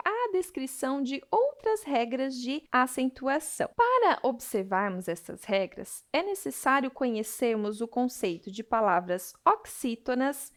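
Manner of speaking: normal talking voice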